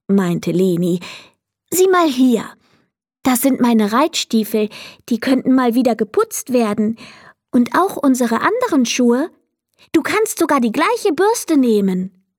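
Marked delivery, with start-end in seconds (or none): 1.7-12.2 put-on higher little girl's voice, keen to help; a girl showing off her idea; eager girl. Leni proposes the boots. (eifriges Mädchen, höhere Stimme)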